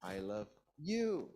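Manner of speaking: slow, with emphasis on final "you"; stress on YOU. Shown as a demonstration. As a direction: keep "I love" low, lift and stretch "you". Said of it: "I'm using emphasis".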